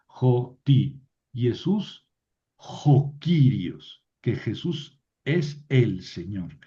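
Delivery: jo Kyrios drawn out very slowly; slow quoted creed. Shown as a demonstration. Teacher quotes Paul's creed slowly to students. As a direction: spell out the confession slowly. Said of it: "que Jesús es Señor".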